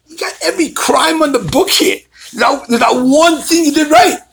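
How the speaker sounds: high-pitched, incredulous scolding; imitated exasperated attorney. Attorney berating clients over every crime, high and exasperated.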